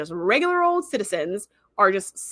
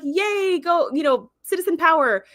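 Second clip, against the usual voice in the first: higher, sing-song fake cheering; mock cheer; 7 semitones higher than usual. Recapping the episode, a mock cheer for citizen power. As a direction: cheer like it's a rally, half joking.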